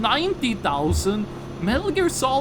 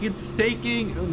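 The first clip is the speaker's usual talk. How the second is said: ordinary talk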